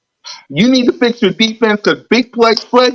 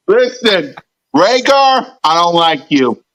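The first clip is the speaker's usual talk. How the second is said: usual speaking voice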